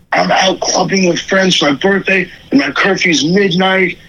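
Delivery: mock high little-brother voice, pleading; whiny kid brother. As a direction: play the younger brother as high and helpless. Imitating younger brother on phone.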